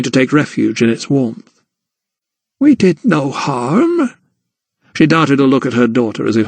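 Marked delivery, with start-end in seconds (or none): 2.6-4.1 slow, higher gran; a lightly voiced old woman pleading; old grandmother. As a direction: gran to Emily, slow and defensive. Grandmother defends herself.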